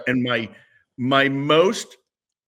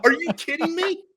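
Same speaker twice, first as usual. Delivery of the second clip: very high-pitched retold disbelief; incredulous retort. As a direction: squeeze it out high, imitating your own amazement. Teller voices own disbelief.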